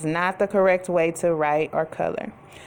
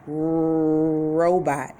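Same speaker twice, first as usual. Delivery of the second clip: sounding out; 4 semitones lower than usual. Shown as a demonstration. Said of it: "Does that start with I".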